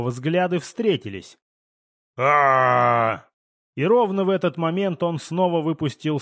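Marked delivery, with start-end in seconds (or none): 2.2-3.2 long, loud bellow; drawn-out guttural roar; monster roar. A narrator's reading. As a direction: roar like a huge beast. Monster roars as eyes meet.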